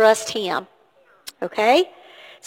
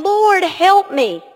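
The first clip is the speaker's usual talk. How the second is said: raised, drawn-out plea for help; crying out; 7 semitones higher, 4 dB louder, slower than usual. Retold or imitated. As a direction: imitate a woman calling out to the Lord. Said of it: "She cried out to him".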